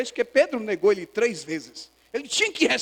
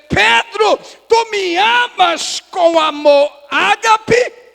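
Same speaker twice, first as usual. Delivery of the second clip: high shout, slow, rising at the end; impassioned questioning; 10 semitones higher, 11 dB louder, much slower than usual. Acted. Jesus asks Peter about agape love, impassioned shout. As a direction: shout the question, drawing out each word.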